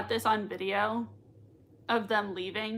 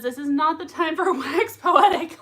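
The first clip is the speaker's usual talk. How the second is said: can't keep a straight face; laughing while reading; 5 semitones higher, 5 dB louder than usual. Real, not acted. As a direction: read it through giggles.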